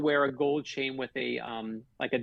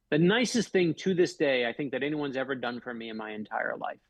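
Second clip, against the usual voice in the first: ordinary talk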